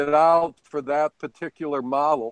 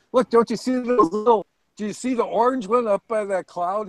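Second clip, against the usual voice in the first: higher-pitched, acted-out insistence; retold eager pointing-out; 6 semitones higher than usual. Pilot showing an onlooker his distant plane, mock insistent. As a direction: act out pointing something out, voice lifted.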